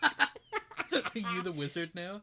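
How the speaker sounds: slow, laughing question. Real, not acted. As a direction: laugh through the words. Laughing disbelief at friend's joke.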